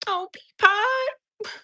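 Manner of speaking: very high, slow, melting coo; adoring coo. Real, not acted. Streamer doting on a viewer's dog Peapod, high adoring coo. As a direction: melt over the pet's name, stretched high.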